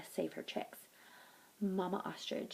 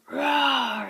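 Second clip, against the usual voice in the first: a big, loud roar, pitched higher; loud lion roar; 7 semitones higher, 14 dB louder than usual. Acted. The lion, stuck in the tunnel, roars loudly. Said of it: "in his lo-, loud roar-like lion voice".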